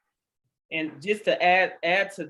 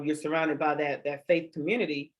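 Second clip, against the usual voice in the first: normal talking voice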